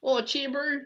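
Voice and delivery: high-pitched mock Kiwi accent; New Zealand accent. Greeting a bro, high New Zealand accent. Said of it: "Try a New Zealand accent".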